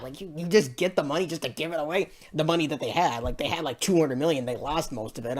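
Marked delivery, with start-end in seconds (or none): none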